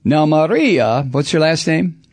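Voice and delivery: ordinary talk, nothing marked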